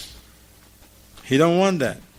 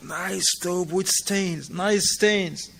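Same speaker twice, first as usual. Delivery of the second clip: higher, slow teasing remark; mock gossip; 6 semitones higher, much slower than usual. People at Asr mocking the stained thobe, slow and teasing. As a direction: tease the stained thobe slowly. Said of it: "Are they say".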